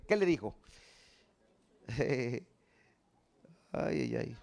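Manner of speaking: ordinary delivery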